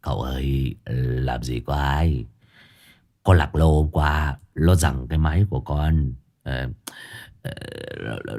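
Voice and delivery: half laugh, half whimper; whimpering excuse. Acted. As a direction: servant pleading innocence to master. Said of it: "cười như mếu".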